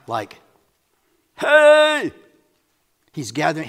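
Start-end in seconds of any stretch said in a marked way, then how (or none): 1.4-2.1 high, loud shout to a crowd; big attention-getting holler; crying out. Shown as a demonstration. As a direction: call out at full outdoor voice. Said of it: "like crying out".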